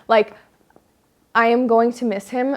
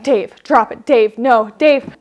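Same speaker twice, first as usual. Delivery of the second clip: louder, firm calls of "Dave, drop it"; stern commands; 7 dB louder than usual. Retold or imitated. Dog mom ordering her dog to drop the turd, firm and loud. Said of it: "I'm like".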